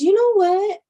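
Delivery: high, loud outburst that fades; upset retort. Retold or imitated. Jumpy girl finally answering the nosy friend, upset. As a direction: snap back, hurt and fed up. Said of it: "the girl got really upset".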